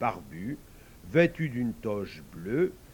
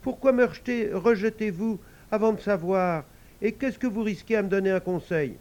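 pleading little devil; 8 semitones higher, 6 dB louder than usual. Acted.

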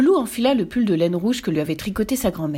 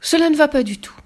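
higher, louder decisive declaration; loud firm refusal; 6 semitones higher, 5 dB louder than usual. A narrator's reading. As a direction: put your foot down.

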